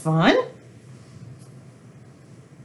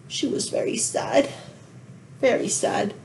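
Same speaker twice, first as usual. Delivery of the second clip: mock-sad whisper; 3 semitones higher, 7 dB quieter than usual. Storyteller's sad aside.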